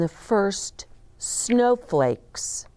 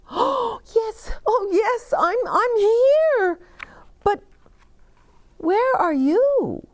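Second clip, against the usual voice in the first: high, loud breathless joy; overjoyed child; 3 dB louder than usual. Acted. Boy hearing Bear's voice after winter, high and overjoyed. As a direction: burst out with happy relief.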